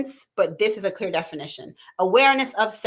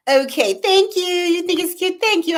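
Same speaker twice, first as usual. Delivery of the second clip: high, louder, pleased gush; delighted thanks; 11 semitones higher, 6 dB louder than usual. Real, not acted. Host thanking a viewer for complimenting her cup, delighted. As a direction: gush happily in a raised pitch.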